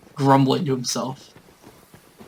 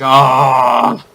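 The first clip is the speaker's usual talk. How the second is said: startled shout; 10 dB louder than usual. Retold or imitated. Party shouts in alarm.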